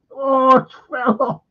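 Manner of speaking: high mock horror; retold horrified cry. Retelling the women's reaction to the arm, mock horror. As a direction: exclaim it high, replaying the onlooker's shock. Repeats the onlooker's horrified line.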